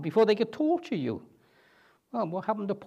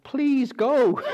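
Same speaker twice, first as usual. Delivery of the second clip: higher, louder mock plea; begging officials; 7 semitones higher, 4 dB louder than usual. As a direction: ask them sheepishly to leave. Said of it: "No, they didn't do that".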